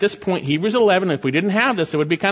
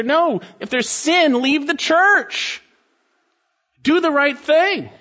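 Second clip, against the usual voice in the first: fervent preaching; 8 semitones higher than usual. Real, not acted. Pastor warning believers against doing it their way, preaching at full force. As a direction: warn them hard from the pulpit.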